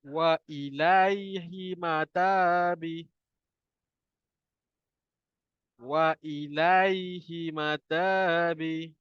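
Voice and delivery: very slow drawn-out Quran recitation. Shown as a demonstration. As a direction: recite slowly for students to repeat. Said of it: "ikut saya baca".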